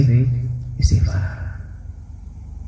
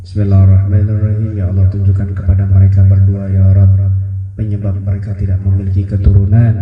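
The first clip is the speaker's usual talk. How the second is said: prayer; 3 semitones lower than usual. Acted. Kiai praying over a childless couple, low and earnest. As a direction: pray over them in earnest.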